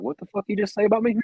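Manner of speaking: high-pitched mock threat, hammed up; mock angry comeback. Mate retelling Johnny's jokey threat, high mock anger. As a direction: squeak out a pretend challenge to a fight. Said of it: "He was like".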